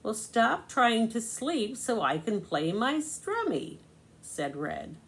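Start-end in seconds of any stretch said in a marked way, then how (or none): 0.0-3.8 higher, indignant snap back; upset. Acted. Red answers Yellow's complaint, higher and indignant. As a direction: throw the complaint right back at him. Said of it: "And Red was also upset".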